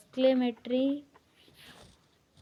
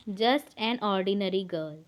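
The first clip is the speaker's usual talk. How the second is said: slow dictation; 3 semitones lower, much slower than usual. Shown as a demonstration. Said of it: "जैसे कि इसके examples है".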